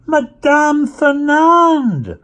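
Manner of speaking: high, loud, drawn-out delighted call; stretched out, singing the name in glad surprise; pleased cry. A narrator's reading. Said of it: "in a pleased voice".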